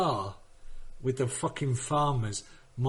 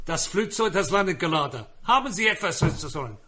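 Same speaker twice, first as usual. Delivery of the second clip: higher-pitched mock German; German accent; 5 semitones higher than usual. Shown as a demonstration. Comic performing for Ollie, mock German accent. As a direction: put on a stiff German accent. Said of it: "do my German accent".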